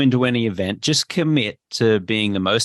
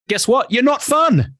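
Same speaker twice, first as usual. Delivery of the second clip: much higher, louder, teasing scold; emphatic call-out; 11 semitones higher, 3 dB louder than usual. Real, not acted. Speaker teases the listener who is no fun, loud jab. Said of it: "teasing punchline".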